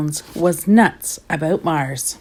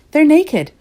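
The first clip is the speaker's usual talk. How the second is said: put-on high, louder, gleeful little-girl line; giggly glee; 11 semitones higher, 7 dB louder than usual. A narrator's reading. Jilly Boo tops her sisters' joke, gleefully. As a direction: blurt it gleefully, pitch jumping up.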